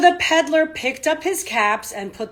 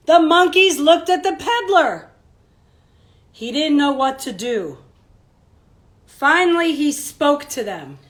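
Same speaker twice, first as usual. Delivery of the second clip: bright, louder storybook narration; reading to children; 5 semitones higher, 9 dB louder than usual. A narrator's reading. Teacher reading a picture book to children, lively storybook voice. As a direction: read it out big for the kids.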